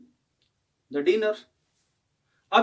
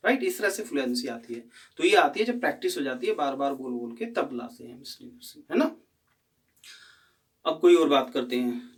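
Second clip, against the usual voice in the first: ordinary talk, nothing marked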